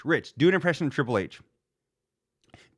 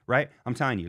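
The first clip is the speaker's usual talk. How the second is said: ordinary delivery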